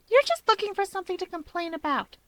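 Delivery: high airy dismissal; a touch of haughty brush-off; dismissive. A narrator's reading. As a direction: wave him off. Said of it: "she dismisses".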